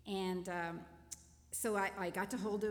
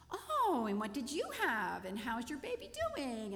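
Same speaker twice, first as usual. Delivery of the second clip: much higher, louder bright questioning; chirpy small talk; 5 semitones higher, 4 dB louder than usual. Retold or imitated. Woman in the shower line asks the others cheerily. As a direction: mimic cheery new-mother chatter. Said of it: "they started at the first of the line".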